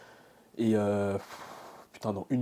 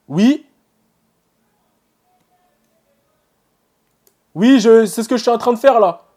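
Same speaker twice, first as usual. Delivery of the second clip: much higher and louder, shouting a reply to someone in the next room; calling out; 11 semitones higher, 14 dB louder than usual. Real, not acted. Student shouts an answer to his mother offscreen, loud call. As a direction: yell it back through the house.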